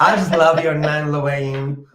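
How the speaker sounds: playful Southern drawl, laughing through it; lightly hammed-up Southern accent. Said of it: "Look at me doing Southern accent".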